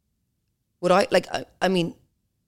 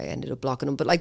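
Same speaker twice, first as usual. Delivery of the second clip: ordinary delivery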